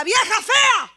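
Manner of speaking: loud, high-pitched yelled insult; mock shout. Neighbour insulting a woman sweeping outside, shouted. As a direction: yell it like a rude neighbour across the street. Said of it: "y me grita".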